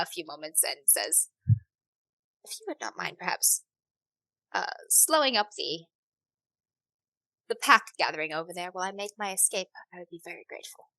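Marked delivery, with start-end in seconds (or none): 2.4-10.8 soft, understated patron voice; discreet polite request. Acted. Hostess asking help to escape the pack, discreet request. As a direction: ask the favour quietly and courteously.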